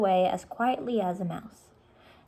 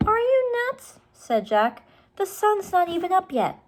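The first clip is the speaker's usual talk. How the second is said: put-on high incredulous boy's voice; lightly voiced indignant boy; 12 semitones higher, 4 dB louder than usual. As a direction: scoff at the crazy idea. Brother scoffs at sister's plan.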